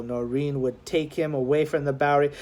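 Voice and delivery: ordinary delivery, nothing marked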